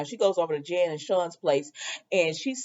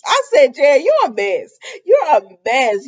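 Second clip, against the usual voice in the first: high, loud exasperated call-out; loud scolding; 6 semitones higher, 8 dB louder than usual. Real, not acted. Viewer telling Jan she's a mess, loud scolding. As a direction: scold her at full voice, playfully.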